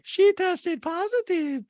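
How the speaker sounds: high, loud, drawn-out falsetto; hammed-up falsetto announcement; Diana impression. Diana tells guests who's missing, in slow mock falsetto. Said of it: "And Diana's like".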